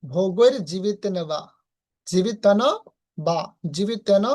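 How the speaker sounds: জীবিতেন said slowly, নয় lightly lifted; slow careful pronunciation. Shown as a demonstration. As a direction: pull জীবিতেন slightly upward. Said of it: "একটু হালকা উপরের দিক টানতে হবে".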